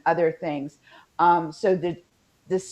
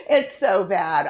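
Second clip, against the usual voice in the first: slow, louder, real laughing embarrassment; 6 dB louder, slower than usual. Real, not acted. Speaker apologizing to listeners for the accent, laughing. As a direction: laugh at yourself as you say it.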